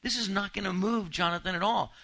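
preacher pressing a point with energy; emphatic point. Real, not acted. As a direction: drive the point home.

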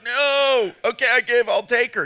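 high, loud put-on protest; mock surrender. Pastor acting a groom forced to marry, mock surrender. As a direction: cave in with hammed-up reluctance.

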